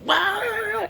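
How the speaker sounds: high, loud mock shriek; freaking-out shriek. Cult lady freaking out at the energy, loud high shriek. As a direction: let out a hammed-up yelp of alarm. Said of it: "It freaks out".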